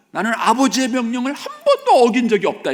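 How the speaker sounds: high, loud mock protest; aggrieved elder son. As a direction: insist loudly on your own record. Voicing the elder son's protest.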